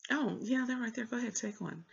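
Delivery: imitating the guy librarian. Mocking the lazy guy librarian pointing at the stacks, high and breezy. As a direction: toss it off like you can't be bothered.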